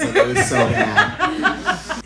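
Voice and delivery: very high, slow, laughing; drawn-out emphasis. Acted. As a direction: drag it out for the laugh. Exaggerating how bad.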